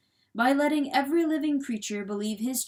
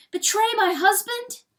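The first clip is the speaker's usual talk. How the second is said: high, loud, rising question; aghast cry; 12 semitones higher, 7 dB louder than usual. A narrator's reading. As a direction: cry it in disbelief, pitched up. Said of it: "cried Pertolote".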